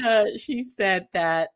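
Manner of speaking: normal talking voice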